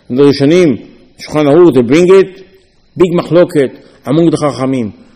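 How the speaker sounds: ordinary talk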